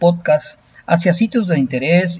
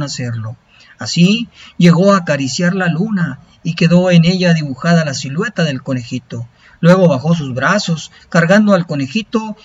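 epic fantasy narrator; 3 semitones higher, 3 dB louder than usual. A narrator's reading. Narrates an Aztec legend.

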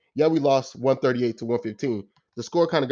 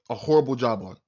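ordinary talk

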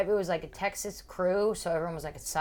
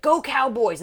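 imitated crowd hype; 6 semitones higher, 8 dB louder, slower than usual. Comedian hyping up crowd.

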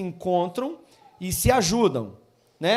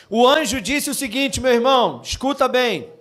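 full-force preaching; 8 dB louder, slower than usual. Acted. Pastor proclaims joy to the congregation, loud preaching. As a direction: preach it out to the whole church.

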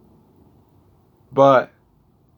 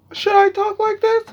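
much higher, louder mock voice, trying it on; squeaky high voice test; 4 dB louder than usual. Wondering how to sound, tries a high squeaky voice. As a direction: squeak it up like testing a new voice. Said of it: "I used to be like".

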